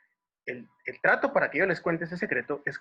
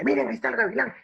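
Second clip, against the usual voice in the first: high, louder call to look; 10 semitones higher, 5 dB louder, faster than usual. Retold or imitated. Dad to children on sighting a hawk, excited calling out. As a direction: point it out with an urgent high call.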